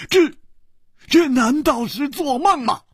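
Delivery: high-pitched stunned exclamation; stammered high cry of amazement; astonished disbelief. Acted. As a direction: blurt it out, unable to believe it. Astonished reunion, cannot believe eyes.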